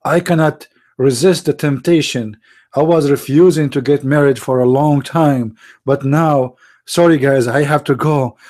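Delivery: usual speaking voice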